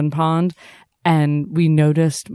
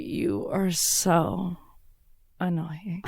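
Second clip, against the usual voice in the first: slow, drawn-out annoyance, dropping softer at the end; mock exasperation; 7 dB quieter, much slower than usual. Reader telling the author off, slow mock exasperation. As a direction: stretch it out, then let it fall away. Voicing her reaction to the author.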